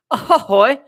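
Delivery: a high, loud, hammed-up British-accent laugh and Ahoy; mock British laugh. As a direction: playfully imitate the girl's laugh in a British accent. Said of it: "I imagine it in a, like, a British accent".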